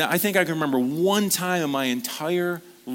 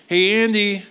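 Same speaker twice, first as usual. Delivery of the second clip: loud call, pitched up high; 7 semitones higher, 6 dB louder than usual. Retold or imitated. Dad calling his son to do chores, loud call. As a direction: call him from across the house.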